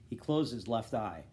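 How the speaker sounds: ordinary delivery, nothing marked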